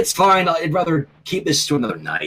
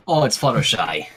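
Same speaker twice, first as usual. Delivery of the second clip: ordinary talk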